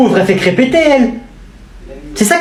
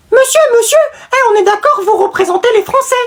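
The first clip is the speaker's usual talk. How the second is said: much higher, excited little voice; eager kid calling out. Acted. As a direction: call out to the president eagerly. Élève interpelle le président. (gamin surexcité, voix très aiguë)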